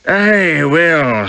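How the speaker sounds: put-on high, loud Scots accent; broad Scots, pitched well up; Peitingi's astonishing accent. A narrator's reading. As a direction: open with a big Scottish accent. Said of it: "in that astonishing accent".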